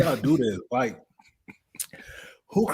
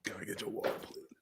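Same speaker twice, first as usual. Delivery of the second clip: breathless, softer pleading whisper; 20 dB quieter, much faster than usual. Real, not acted. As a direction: beg for water in a strained whisper. Begging for water after hot wing.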